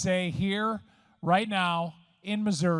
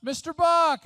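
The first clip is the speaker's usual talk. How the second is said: put-on high, loud child's call, much higher pitch; little kid calling; 12 semitones higher, 7 dB louder than usual. A little kid in the stands calling up to the announcer in a high, loud voice. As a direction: call up to the booth like an eager kid. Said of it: "this little kid's voice is down in front going".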